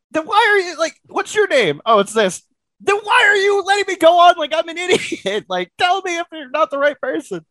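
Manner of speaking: mock fury at being made a fool; retold high exasperated yell; exasperated rant.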